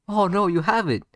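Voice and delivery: loud, high taunting cry; mock realization. Naruto taunting Sasuke about his Sharingan, mock surprised. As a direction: act out a gleeful false discovery.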